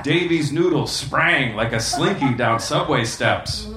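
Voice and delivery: normal talking voice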